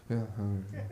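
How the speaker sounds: deep, quiet and offhand yeah all right; low, cool man. Retold or imitated. Stranger shrugging off the thanks, low and cool. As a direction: brush it off like it's his job. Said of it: "He's like".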